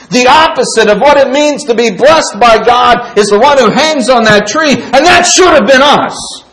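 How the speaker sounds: loud, high-pitched pulpit preaching at full force; fiery preaching. Acted. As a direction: drive every line home to the congregation.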